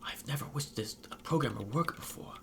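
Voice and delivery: soft, hushed aside; whisper. A narrator's reading. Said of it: "leans closer to whisper".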